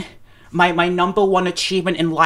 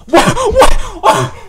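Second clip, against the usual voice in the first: very high, loud startled squeal; shocked scream; 8 dB louder than usual. Real, not acted. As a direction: scream the disbelief out. Sudden shocked reaction.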